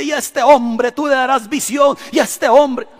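put-on repeating the blessing over the young man, loud and slow; repeated prayer. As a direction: declare it over him slowly. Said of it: "Y repetía constantemente".

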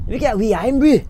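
high, loud put-on Scottish accent. Asked for his best Scottish accent, a loud Scottish accent. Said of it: "Give me your best Scottish accent".

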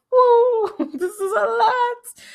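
very high, drawn-out mock whoa; overwhelmed reaction. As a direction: squeal it in playful overwhelm. Acting own overwhelmed reaction.

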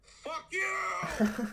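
high, loud mock outburst; mock angry shout. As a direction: yell it like a bitter rival fan. Rival fan outburst.

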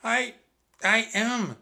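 high, halting breathless reply; gasping. A narrator's reading. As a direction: gasp it out mid-effort. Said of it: "gasped Annie".

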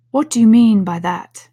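loud, stern caterpillar. A narrator's reading. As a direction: demand it sternly. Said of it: "said the caterpillar sternly".

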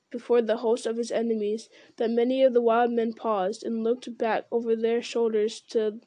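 usual speaking voice

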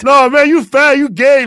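put-on high, loud kid yelling insults; kid shouting. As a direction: shriek it like an angry kid. Kid yells back at him.

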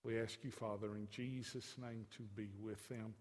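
quiet closing prayer voice. Real, not acted. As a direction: pray earnestly over the congregation.

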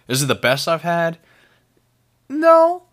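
loud, high, emphatic answer. Real, not acted. Reviewer answers his own question with a loud no. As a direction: shout the no down hard. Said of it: "asks himself, then answers".

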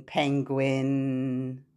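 ordinary talk, nothing marked

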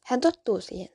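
ordinary delivery

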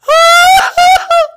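loud, very high fake bawl; hammed-up sobbing howl; mock crying wail. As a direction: wail like an exaggerated crybaby. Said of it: "I literally will cry. I'm like".

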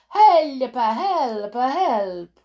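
high mock cries for help; mock yelling. Witch imitating teachers standing on desks, high mocking yells. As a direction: yelp it in mockery, falling off each time. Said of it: "and yelling".

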